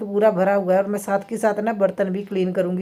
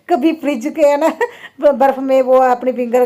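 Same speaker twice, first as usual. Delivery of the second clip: words broken up by real laughter; laughing about him icing his finger; 3 semitones higher, 4 dB louder than usual. Real, not acted. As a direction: giggle through the words. Laughing while retelling husband's mishap.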